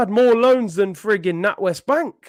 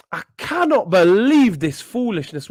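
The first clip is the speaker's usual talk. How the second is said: normal talking voice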